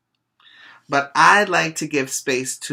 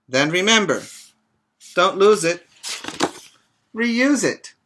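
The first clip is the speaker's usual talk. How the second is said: put-on reading to children; 3 semitones higher, 4 dB louder than usual. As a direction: read it out warmly to a group of children. Reads picture book to children.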